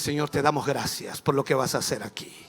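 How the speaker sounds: low, soft prayer of thanks; imitated quiet, assured believer praying; calm prayer. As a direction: pray it evenly, voice dropped. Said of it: "levantas tu mano y dices".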